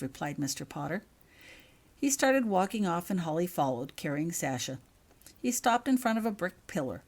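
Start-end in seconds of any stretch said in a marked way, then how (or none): none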